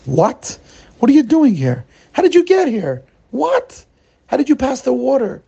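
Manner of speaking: stunned disbelief, climbing high; shocked sage asking how; astonished. Acted. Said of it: "His eyes open wide".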